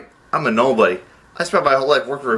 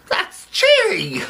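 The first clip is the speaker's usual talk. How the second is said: long, very high wail; horrified cry; much slower than usual. Acted. Accountant balking at cooking the books, horrified wail. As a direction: drag it out in a high shriek.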